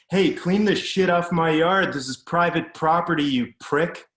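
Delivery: put-on loud, high furious yelling at a kid; angry yell. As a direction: bellow it across the lawn, raging. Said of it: "He yelled something at the kid".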